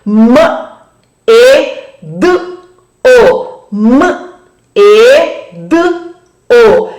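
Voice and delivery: M, E, D, O each sounded separately with pauses between them; letter by letter. Shown as a demonstration. Teacher shows the class the sounds in "medo", slow and separated. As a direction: spell out the sounds of "medo" slowly, one by one. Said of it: "Quais os sons que formam a palavra medo?".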